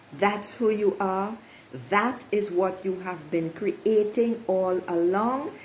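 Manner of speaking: ordinary talk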